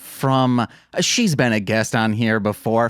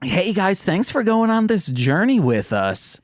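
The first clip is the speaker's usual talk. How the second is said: mock cheery sign-off; 7 semitones higher than usual.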